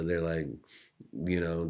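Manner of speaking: ordinary talk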